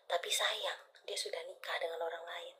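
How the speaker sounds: dropped to a hushed whisper; secretive soft whisper. Real, not acted. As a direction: whisper the bad news. Reader delivering sad twist.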